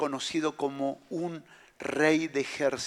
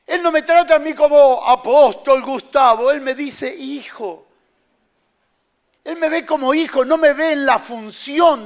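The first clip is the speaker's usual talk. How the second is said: louder, higher preaching; forceful preaching; 8 semitones higher, 10 dB louder than usual. Acted. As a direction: press the point hard on the crowd. Preacher climaxes on sonship.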